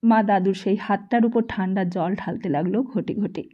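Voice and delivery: usual speaking voice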